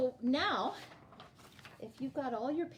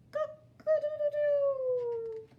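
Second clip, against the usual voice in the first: much higher, slow stretched rooster crow; 6 dB louder, slower than usual. Acted. Teacher voicing the rooster for her class, slow high crow. Rooster voiced in picture book.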